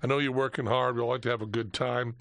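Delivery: a polite English bobby, low-key; English accent. Retold or imitated. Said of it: "He's saying it all with an English accent".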